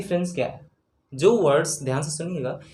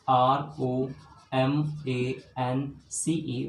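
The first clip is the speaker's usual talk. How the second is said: slow soft spelling; 4 semitones lower, 4 dB quieter, much slower than usual. Shown as a demonstration. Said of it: "teacher spells out letters".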